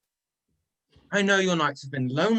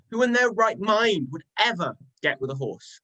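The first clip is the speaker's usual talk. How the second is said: usual speaking voice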